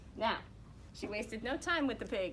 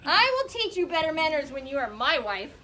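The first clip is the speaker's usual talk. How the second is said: angry rooster; 7 semitones higher, 14 dB louder than usual. Acted. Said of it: "The rooster was furious".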